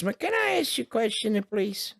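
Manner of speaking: high-pitched old woman imitation; elderly woman. Old woman politely asking the comedian after his show, imitated high. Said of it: "She was, like, eighty-five years old".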